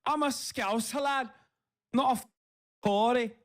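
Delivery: put-on over-the-top Scouse accent shout. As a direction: bellow it like a proud Liverpool lad in Scouse.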